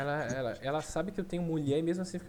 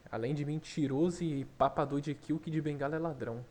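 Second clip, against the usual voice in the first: ordinary talk, nothing marked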